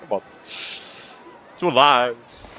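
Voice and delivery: normal talking voice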